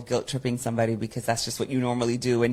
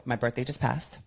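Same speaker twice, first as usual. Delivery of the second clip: ordinary talk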